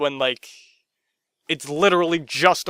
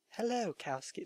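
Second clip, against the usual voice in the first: much softer seductive attempt; sexy voice; 5 semitones higher, 17 dB quieter than usual. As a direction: breathe it as a seduction. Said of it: "That's not very sexy".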